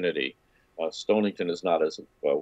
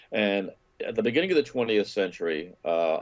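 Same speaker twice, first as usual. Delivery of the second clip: usual speaking voice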